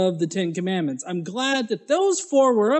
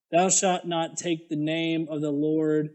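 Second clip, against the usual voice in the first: low, slow, quiet scripture reading; hushed mock-holy recitation; mock solemn scripture reading; 6 semitones lower, 4 dB quieter than usual. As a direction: intone it low like a solemn preacher. Reading the commandments aloud.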